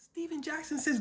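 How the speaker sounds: ordinary delivery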